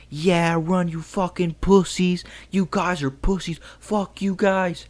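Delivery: high mocking jeer from a passing car; retold taunting yell. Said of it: "they're screaming".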